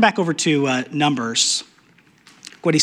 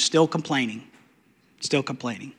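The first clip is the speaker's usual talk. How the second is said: softer, lower second time; fading repeat; 4 semitones lower, 8 dB quieter than usual. Acted. Preacher notes nothing has changed, fading. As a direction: let still complaining die away.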